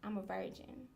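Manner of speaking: soft, low retold confession; half whisper. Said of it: "I half whispered".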